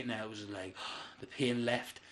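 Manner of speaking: soft, slow relieved gasp. Retold or imitated. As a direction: sink into relief. Gas relief, gasps.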